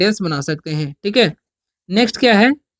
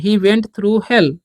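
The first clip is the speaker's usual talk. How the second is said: slow dictation; much slower than usual. Shown as a demonstration. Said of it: "नरक होता है".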